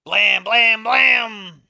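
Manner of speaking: loud mock gunshots, blam blam; gunshot noises. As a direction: fire off imitated shots loudly. Gunshots acted in the story.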